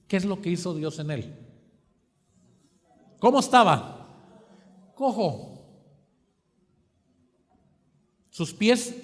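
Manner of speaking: loud, driving sermon delivery, punching key words; building preacher's call; fervent preaching. Acted. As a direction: preach it out to the congregation. Preaching at full force.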